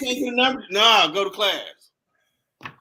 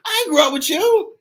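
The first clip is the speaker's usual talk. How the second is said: indignant retort; 10 semitones higher, 5 dB louder than usual. Retold or imitated. Retorting to hallway stalkers.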